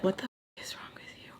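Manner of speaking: barely voiced disbelief; whisper. Whispering at the woman who keeps smirking. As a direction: whisper it, stunned. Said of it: "all I can say is".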